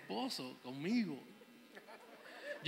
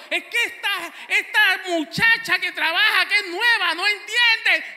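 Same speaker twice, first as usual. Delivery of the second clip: complaining wife; 11 semitones higher, 9 dB louder than usual. Retold or imitated. Esposa se queja del trabajo.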